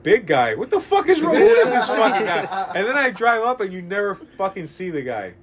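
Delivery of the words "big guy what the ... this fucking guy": loud, high incredulous rant; hammed-up indignant yelling; mock outrage. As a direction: throw it back in comic disbelief. Mock outrage at the clerk's nickname.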